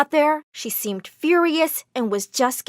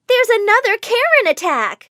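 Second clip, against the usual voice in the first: a loud shout raised high across the school; yelled alert; 9 semitones higher, 3 dB louder than usual. Acted. Student yelling to the crowd about another Karen attack, loud and high. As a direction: call out the alarm loud and high. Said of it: "someone yelled".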